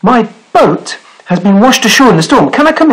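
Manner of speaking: high, eager little sailor voice; high rabbit voice. Acted. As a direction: squeak it out like a small rabbit. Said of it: "said the creature".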